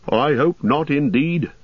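innkeeper Butterbur. Acted. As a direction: chatter like a nervous landlord.

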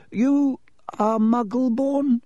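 put-on old headmaster voice. A narrator's reading. Headmaster Dippet speaks.

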